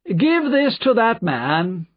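high, slow aristocratic order; disdainful nobleman's command; haughty Marquis. A narrator's reading. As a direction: order it loftily, drawn out high.

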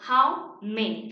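"how many" slow and loud, for repeating; teacher's drawn-out, emphatic "how many"; pronunciation model. Shown as a demonstration. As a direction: sound out "how many" slowly and firmly. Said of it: "repitan en casa".

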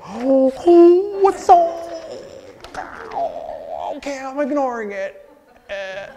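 very high, loud, silly flustered voice; mocking flustered public. Mocking the public asked to ignore a dog, high and flustered. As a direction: squeal it like someone failing to play it cool. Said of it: "they go".

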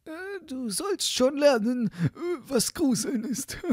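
high, put-on sexton voice; high-voiced schemer with hesitant "äh"; scheming sexton. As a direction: plot to yourself in a high character voice.

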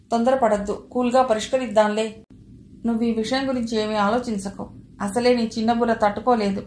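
ordinary delivery, nothing marked

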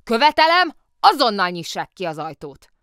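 high, very loud outburst, easing at the end; angry shouted demand. A narrator's reading. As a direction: demand furiously to be released. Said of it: "dühös kiáltozás ütötte meg a fülét".

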